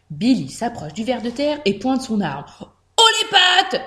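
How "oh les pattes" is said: high, commanding order; as serious as possible. A narrator's reading. Young bandit practising on a worm, as serious as possible. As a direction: order hands up in deadly earnest. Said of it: "dit-il le plus sérieusement possible".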